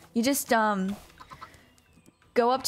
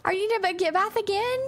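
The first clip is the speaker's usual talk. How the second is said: cutesy pet voice; 10 semitones higher, 4 dB louder than usual. Real, not acted. Talking to her pet.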